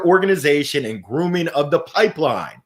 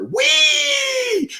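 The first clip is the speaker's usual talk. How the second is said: very high, long squeal of "whee"; high-pitched gleeful whoop; roller-coaster whee. Retold or imitated. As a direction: squeal like a rider on the drop. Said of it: "You were like a roller coaster".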